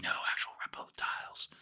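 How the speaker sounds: hushed, imitated conspiracy caller; conspiratorial whisper. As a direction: whisper it like a secret truth. Caller confides conspiracy, acted.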